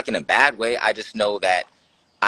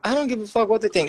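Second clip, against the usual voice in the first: much higher, fast defiant fling; defiant outburst; 13 semitones higher, 3 dB louder, faster than usual. Real, not acted. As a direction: blurt it out, totally unbothered.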